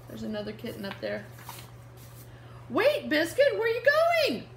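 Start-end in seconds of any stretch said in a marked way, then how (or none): 2.7-4.5 put-on higher, louder girl calling after her puppy; little girl calling. Reading to kids, the little girl calls after Biscuit. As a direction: call out after the runaway puppy.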